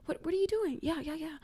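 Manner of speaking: mock whisper. Friends retelling a movie, mocking a hushed walkie-talkie whisper. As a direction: whisper it like you're hiding from monsters. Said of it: "you think you can whisper".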